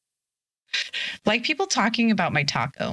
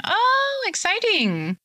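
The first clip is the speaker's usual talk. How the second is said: excited squeal; 11 semitones higher, much slower than usual. Real, not acted. Streamer reacts to news.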